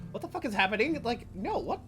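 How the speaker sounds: high confused yelping; bewildered. Acted. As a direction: sputter in total confusion. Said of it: "everyone is just like".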